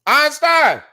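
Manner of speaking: comic housewife guarding her Kelman's, shouting; mock angry yell. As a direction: shriek at Einstein to keep off the coffee. Acted housewife guarding her coffee.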